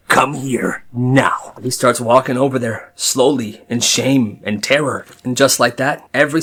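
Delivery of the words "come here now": slow stern guard order; menacing guard. Retold or imitated. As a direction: stretch it out like a huge scary guard. Guard repeats command slowly.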